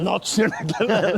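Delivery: laughing as he mimics the bouncer's refusal; laughing retelling. Telling a mate how the doorman turned him away, laughing.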